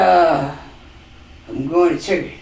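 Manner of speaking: low, soft mumbling husband impression; imitating her husband. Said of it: "That's how he said it".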